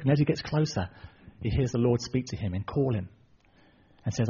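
ordinary talk, nothing marked

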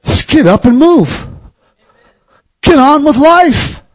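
high, urgent preaching push. Acted. Preacher exhorts church to move on, rising fervor. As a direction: drive the point home to the congregation.

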